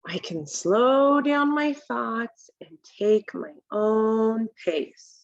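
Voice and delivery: ordinary delivery, nothing marked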